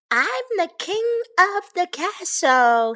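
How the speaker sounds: high, boastful cub's call; proud shout. A narrator's reading. Baby bear on a rock playing king, high shout. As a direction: proclaim it from on high. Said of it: "he shouted".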